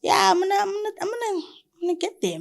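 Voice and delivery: rising eager promise; lifted, eager self-belief; excited. Real, not acted. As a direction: voice jumps high with excitement. Excited about future business.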